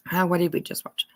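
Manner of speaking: unvoiced whisper to herself; whispered aside. Real, not acted. Reader whispering to herself mid-reading.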